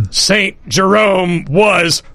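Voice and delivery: slow, drawn-out stern pronouncement; imperious teacher voice. Pretend. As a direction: lay down the verdict like a strict first-grade teacher. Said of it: "invoke the spirit of Missus Champion right now and say".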